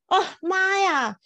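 high, drawn-out oh my god; shocked gasp. Real, not acted. Hearing the gods need an upgrade, a high shocked gasp. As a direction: gasp it out, thrown by the news.

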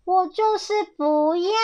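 taunting storybook villain; defiant fox. A narrator's reading. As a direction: refuse stubbornly, stretching the end. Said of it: "小心眼却说".

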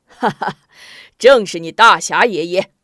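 loud laughing boast; bold laugh. Acted. The hero reveals himself to the general, laughing loud boast. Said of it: "无名侠哈哈大笑".

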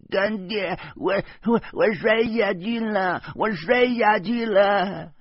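put-on high, slow, shaken child voice; a small girl in shock calling for her godfather; high trembling child cry after nearly falling; frightened little girl crying out. A narrator's reading. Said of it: "她拉着我叫着说".

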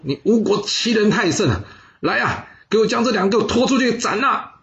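a ruler flinging down the letter in rage; furious cursing ruler. Acted. Duke of Qi cursing Wu's envoys, furious high shout. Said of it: "然后大骂说".

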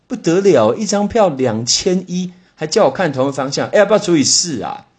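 loud, high-pitched sticker shock; mock outrage. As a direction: balk loudly at an absurd price. Mock outrage at ticket price.

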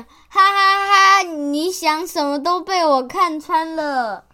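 slow, loud, villainous gloat; gloating mind-reader. Acted. Child playing the gloating mind-reading monster, slow and smug. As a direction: drag out a smug monster laugh. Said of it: "他现在又在讲".

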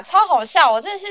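ordinary talk, nothing marked

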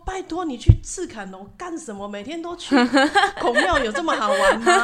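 nagging mom voice, half laughing; exasperated mom. Retold or imitated. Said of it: "连我妈就说".

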